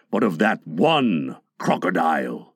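very low, ominous pirate; single it out darkly; correcting Captain Hook. A narrator's reading. Hook names his one dreaded beast, deep correction. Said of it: "Hook corrected him".

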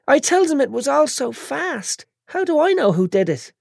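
put-on high, tearful, whiny girl; a bandaged girl fighting tears; whiny young woman. Chantal voiced.

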